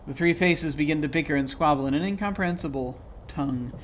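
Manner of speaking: ordinary talk, nothing marked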